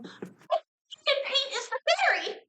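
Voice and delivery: very high, drawn-out falsetto squeal; screaming puppet. Acted. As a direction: squeal it like a tiny puppet, not at full volume.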